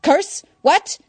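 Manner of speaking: high, loud startled sputter. Acted. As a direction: blurt it, caught out. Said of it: "Nigma sputtered".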